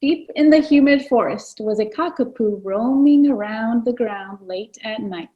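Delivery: ordinary delivery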